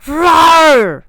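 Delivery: huge high roaring growl; growling tiger voice. Pretend. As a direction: roar like a fierce tiger. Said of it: "we have a request for the tiger voice".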